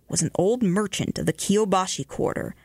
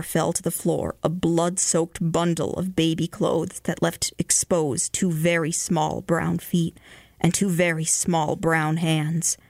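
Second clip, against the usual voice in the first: usual speaking voice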